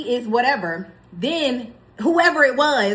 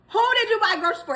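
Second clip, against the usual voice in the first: mock demanding; 8 semitones higher, much faster than usual. Confronting husband on phone.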